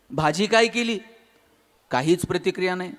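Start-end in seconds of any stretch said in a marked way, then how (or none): none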